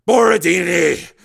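loud, drawn-out angry growl; through gritted teeth. A narrator's reading. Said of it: "said through gritted teeth".

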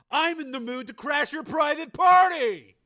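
high angry shout at nobody; furious shout into the empty night; voice rising to a shout. A narrator's reading. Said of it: "Joe's voice rose".

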